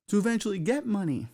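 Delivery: normal talking voice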